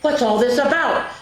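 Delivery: loud excited crowd call; shouting crowd. Acted. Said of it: "the people came shouting".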